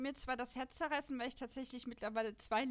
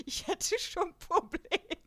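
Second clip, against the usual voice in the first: laughing; 6 semitones higher, 6 dB louder, much slower than usual. Real, not acted. Laughs while admitting indecision.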